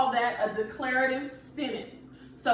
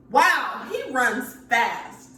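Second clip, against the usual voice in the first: loud, slow "Wow, he runs fast" shout; excited yell; 7 dB louder, much slower than usual. Shown as a demonstration. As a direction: shout it like you're amazed. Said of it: "I was kind of yelling there, and I was a little bit excited".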